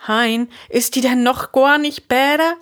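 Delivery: high, anxious rising question; highest fear. A narrator's reading. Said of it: "in höchster Angst". (vull Angst fragen)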